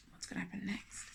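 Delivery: whispered, building suspense. Real, not acted. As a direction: lean in and whisper it. Suspense question to children.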